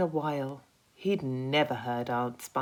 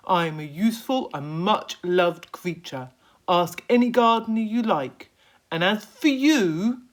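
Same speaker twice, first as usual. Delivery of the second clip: louder indignant worm voice; a worm defending his honour; indignant Earthworm; 6 semitones higher, 10 dB louder than usual. Acted.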